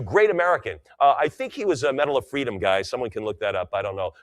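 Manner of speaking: ordinary talk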